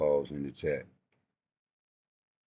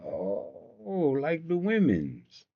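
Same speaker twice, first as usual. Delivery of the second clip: high, slow sneer; mocking remark; 8 semitones higher, much slower than usual. Mocking the host for blocking, slow sneer. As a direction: draw it out with contempt. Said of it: "I said".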